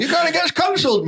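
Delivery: high, fast and laughing; half-shrieking with laughter at the bad accent; laughing protest. Real, not acted. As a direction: squeal it through laughter.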